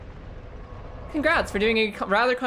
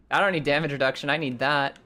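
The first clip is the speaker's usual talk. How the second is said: usual speaking voice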